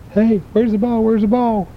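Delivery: high puzzled robot voice. Acted. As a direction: call out lost in the dark, high. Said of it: "the robot said".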